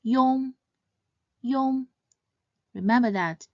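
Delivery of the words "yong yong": "Yong" as one quick, seamless syllable; Mandarin final yong. Shown as a demonstration. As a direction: glide "yong" smoothly without a break. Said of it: "pronounced quickly and seamlessly as one syllable".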